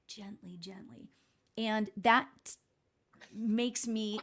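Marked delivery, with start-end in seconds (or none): 0.1-1.1 soft fading repetition. Acted. Echoing the image of stepping gently.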